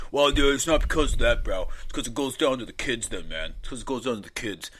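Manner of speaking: lightly voiced preachy bro; a hint of a preachy dude; mock bro voice. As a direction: mimic a self-righteous bro. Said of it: "what everyone does whenever they want something banned".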